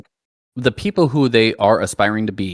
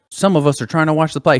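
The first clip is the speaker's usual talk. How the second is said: higher-pitched imitation of an annoyed theatregoer; retold skit retort, pitched up; mock arguing; 6 semitones higher than usual. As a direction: complain like a bothered audience member, acted out. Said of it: "they just start arguing back and forth".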